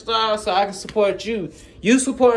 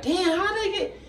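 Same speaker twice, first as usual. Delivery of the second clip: mock amazement; 13 semitones higher than usual. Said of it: "I be like".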